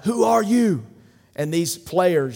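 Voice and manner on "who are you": high, loud drill-field shout; coach's yell. Retold or imitated. Coach Herman Boone yelling at his players, loud and high. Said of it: "he yells out".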